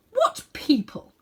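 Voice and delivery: slow, lofty queen's question; cold queen. A narrator's reading. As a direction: ask it slowly, like a haughty queen. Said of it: "asked the Queen".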